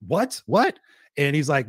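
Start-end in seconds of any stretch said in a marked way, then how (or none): 0.1-0.4 sharp rising what, higher; a startled first what; surprised what. Retold or imitated. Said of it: "And I was like". 0.5-0.8 higher, louder rising what, in shock; can't believe what he just heard; a stunned second what, pushed up and louder; louder disbelieving what. Retold or imitated. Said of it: "And I was like".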